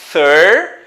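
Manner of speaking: thir isolated, loud, with English th; "thir" stretched out as a sound demo. Shown as a demonstration. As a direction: project "thir" alone, starting on th. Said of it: "observe o som do T H".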